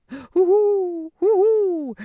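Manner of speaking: high loud owl hoots. Acted. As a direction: call out hoo-hoo like owls. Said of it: "They both hooted".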